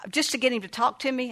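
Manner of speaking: ordinary talk, nothing marked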